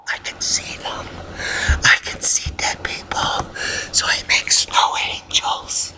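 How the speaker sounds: eerie breathy whisper; spooky whisper. Pretend. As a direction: whisper it like a ghost-seeing child. Spooky dead people joke.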